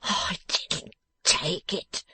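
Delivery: slow, breath-heavy miserable whine; dismal. A narrator's reading. Beaten youth denying it to the dressmaker, dismal. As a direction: drag it out pitifully. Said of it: "the dismal youth replied".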